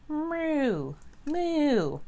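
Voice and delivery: playful high mooing like a cow. Pretend. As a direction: moo like a silly cow. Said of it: "Cows go moo".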